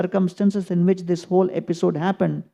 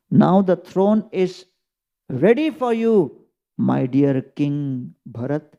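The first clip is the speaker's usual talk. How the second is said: slow, ceremonious offer; slower than usual. Acted. Sage offers Bharat the throne.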